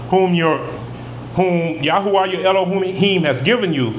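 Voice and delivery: ordinary delivery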